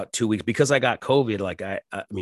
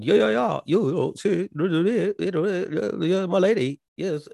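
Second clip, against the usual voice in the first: high, hammed-up posh British accent; mock British royalty; 10 semitones higher than usual. Mocking Travolta's alien for viewers in a mock British accent. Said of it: "this British royalty accent".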